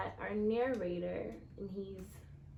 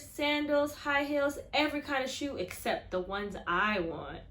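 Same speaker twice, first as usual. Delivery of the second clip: louder, higher storybook reading; expressive story reading; 4 semitones higher, 9 dB louder than usual. A narrator's reading. Teacher reading the kickball scene to her class, lively storytelling. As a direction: read it big for the class.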